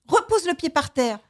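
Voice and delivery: high, firm storybook command; stern order. A narrator's reading. Elephant scolds itself in story.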